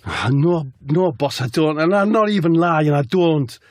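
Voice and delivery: high-pitched eager denial; hammed-up pleading player; Jack. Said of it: "Jack says".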